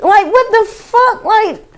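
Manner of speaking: high incredulous what; mock shocked squeal; disbelief. Reacting to porn titles.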